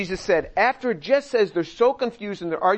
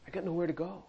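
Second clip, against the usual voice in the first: softer, sinking repeat of the admission; quiet resigned Peter; 12 dB quieter, faster than usual. Pretend. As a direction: let it fall away almost to yourself. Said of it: "Peter's answer voiced".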